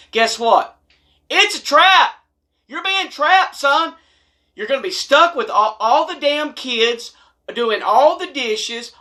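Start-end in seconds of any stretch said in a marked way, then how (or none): 1.3-3.9 high, loud "it's a trap" warning; dramatic warning. Acted. Warning the husbands, high and loud. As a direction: sound the alarm at him.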